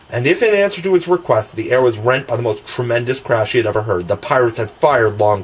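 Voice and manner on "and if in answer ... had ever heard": usual speaking voice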